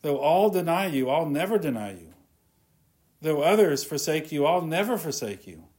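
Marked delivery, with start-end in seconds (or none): none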